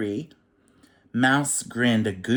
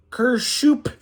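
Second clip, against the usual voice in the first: high, loud snorting noise; a cartoon snort; 6 dB louder than usual. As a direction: snort it out hard. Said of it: "he snorted".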